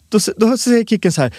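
ordinary talk, nothing marked

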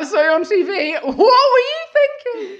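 high, loud, laughing teasing; laughing mock friends. School friends mocking her TV outfit, high laughing tease. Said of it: "All my friends were like".

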